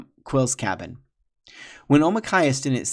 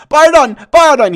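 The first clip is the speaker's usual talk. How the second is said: high, loud pleading cry; panicked begging shout; cried out; 19 dB louder than usual. Acted. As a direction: beg for mercy at full voice. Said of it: "he cried".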